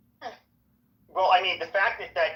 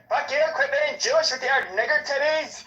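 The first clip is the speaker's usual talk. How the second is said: high, strained imitation of a funny talker; fire captain's funny voice; 9 semitones higher than usual. Guy fires back at the woman, funny voice. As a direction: mimic his angry comeback, pitched right up. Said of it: "so I'm doing his voice".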